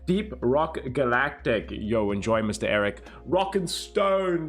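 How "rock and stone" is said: slow, drawn-out higher war cry; game battle cry. Pretend. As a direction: chant it like a dwarf rallying the team.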